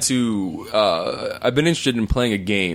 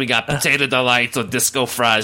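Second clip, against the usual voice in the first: put-on sassy old diner waitress voice; 2 semitones higher than usual. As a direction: call it out like a brassy waitress.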